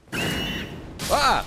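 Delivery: high, loud playful "Ah!"; mock scream. Real, not acted. Gamer copying a screaming bird, sudden playful shriek. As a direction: echo the bird's scream for fun. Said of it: "so I decided to scream".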